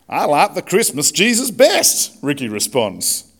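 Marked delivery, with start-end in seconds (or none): 0.1-2.1 hammed-up Southern race driver protesting; Southern accent. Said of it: "Can I do a southern accent?".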